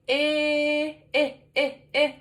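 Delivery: repeated short eh sound; short e sound said over and over. Shown as a demonstration. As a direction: stress the short eh, eh, eh. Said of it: "we mix up the I and the eh".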